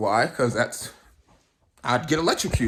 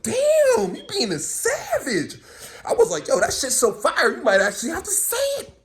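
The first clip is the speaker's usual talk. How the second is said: high, hyped inner hype man; 12 semitones higher than usual. Retold or imitated. Talking to himself about cussing her out, high and hyped. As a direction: cheer himself on about a savage line. Voices his own inner voice.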